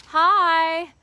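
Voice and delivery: calling out a bright hi; loud hello called out; loud greeting. Real, not acted. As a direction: shout hi across the yard. Loud greeting to arriving person.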